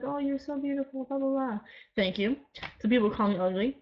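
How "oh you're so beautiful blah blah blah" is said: higher, lightly gushing mock fan; a touch of breathless gushing fan; gushing fan imitation. Vlogger mimics nice commenters, higher gushing mock fan. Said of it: "really nice and all like".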